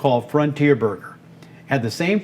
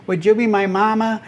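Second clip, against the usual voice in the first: little duck voice; 7 semitones higher than usual. Retold or imitated. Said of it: "in this little duck voice".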